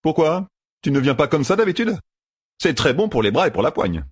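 high, mock-innocent questioning; cheeky young gambler. Matt teasing Seleucia about his climb, cheeky. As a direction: answer with breezy bravado.

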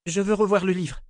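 high-voiced heroine, insistent; captive woman demanding. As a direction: pitch it up as an urgent demand. Dit Kalan.

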